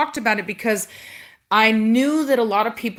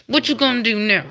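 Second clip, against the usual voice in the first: usual speaking voice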